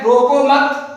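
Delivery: रोको मत said as one unit, then stop; pause after मत. Shown as a demonstration. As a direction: join रोको to मत and break after मत. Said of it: "यही same symbol आप कहां लगाया". (रोको मत एक साथ बोलकर मत के बाद रुकें)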